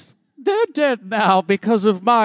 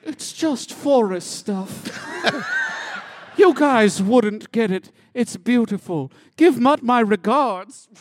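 hammed-up creature voice; 6 semitones higher, 5 dB louder than usual. Creature dismisses them from tent.

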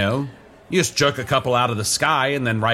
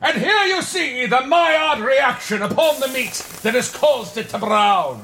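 pompous TV chef; 7 semitones higher than usual. Acted. Chef narrating the Maillard reaction.

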